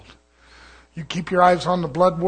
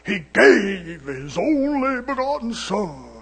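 mimicking Satan; 4 semitones higher, 5 dB louder, slower than usual. Satan quoting scripture like God, drawn-out mock-holy delivery. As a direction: drag it out into a showy preacher's cry, then sink back. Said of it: "that's the way he talks".

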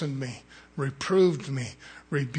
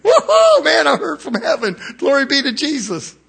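ecstatic shout; 12 semitones higher, 11 dB louder than usual. Retold or imitated. Preacher relives celebrating his near miss with a joyful holler. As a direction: whoop way up high and loud, crying out for joy. Imagined joy at escaping the police.

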